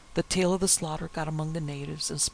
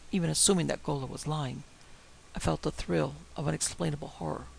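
usual speaking voice

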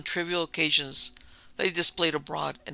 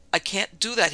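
louder, higher protest; crying out; 5 semitones higher, 5 dB louder than usual. A narrator's reading. Son refusing his father's money offer, crying out. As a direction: burst out refusing. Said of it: "he cried".

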